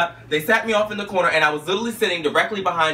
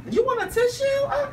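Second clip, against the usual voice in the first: mock concerned; 4 dB quieter than usual. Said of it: "I was like".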